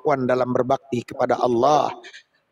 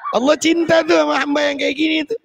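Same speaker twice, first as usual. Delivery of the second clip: laughing; 12 semitones higher than usual. Real, not acted. Laughing comment, high.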